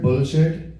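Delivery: "bullshit" in an Indian accent, swallowed l. Shown as a demonstration. Comic introducing his favorite word, "bullshit" in an Indian accent. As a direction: say "bullshit" the Indian way, BOH-shit. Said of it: "saying it in an Indian accent".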